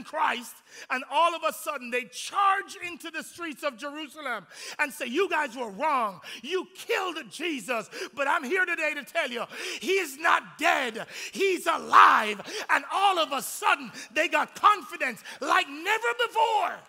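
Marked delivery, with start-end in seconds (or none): 5.0-12.4 triumphant proclaiming. Acted. Disciples announce the resurrection to the crowds, shouting triumphantly. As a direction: build to a shout on alive.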